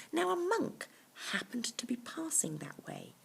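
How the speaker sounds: plain reading voice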